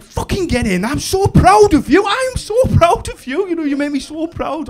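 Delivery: high, gushing proud dad; overjoyed pride. Retold or imitated. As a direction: gush with pride, pitch soaring. Dad overjoyed, praising his daughter.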